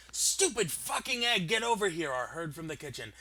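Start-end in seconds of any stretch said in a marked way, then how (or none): 0.1-2.1 higher, louder furious yell at an egg; angry shouting. Acted. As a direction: yell in rage at the runaway egg. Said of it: "an angry man shouting".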